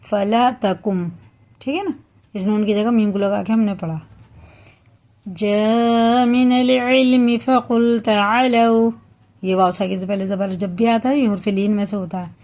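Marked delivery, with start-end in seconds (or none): 5.3-8.9 slow melodic chanted recitation; Quran recitation. Acted. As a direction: chant the verse slowly with long vowels.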